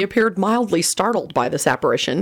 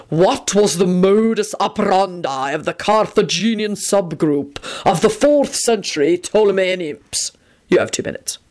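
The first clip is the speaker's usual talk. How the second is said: pleased, menacing sphinx. Acted. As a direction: pronounce the riddles like an ancient sphinx.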